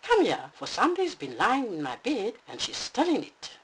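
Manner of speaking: high, loud excited calling; excited little bear. Acted. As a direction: bounce with excitement. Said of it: "Bruno danced with excitement".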